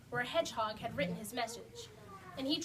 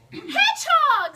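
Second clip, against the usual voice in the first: calls out his friend's name, much louder and higher; a big happy cry of welcome; joyful shout; 13 semitones higher, 11 dB louder, slower than usual. Acted. Rabbit greeting Hedgehog after winter, overjoyed.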